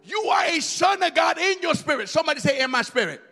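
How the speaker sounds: ordinary talk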